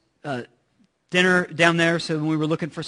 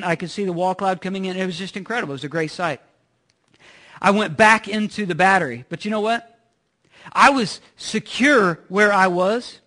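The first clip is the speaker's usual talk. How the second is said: ordinary talk, nothing marked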